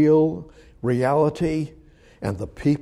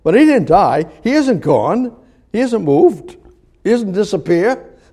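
emphatic preaching; 6 semitones higher, 6 dB louder than usual. Real, not acted.

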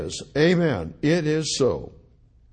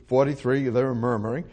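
usual speaking voice

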